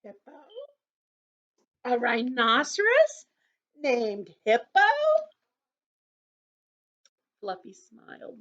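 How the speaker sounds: put-on gentle storybook narration for kids; children's story reading. A narrator's reading. Teacher reading a story to children, gently. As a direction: read it to the circle like a picture book. Reads picture book to children.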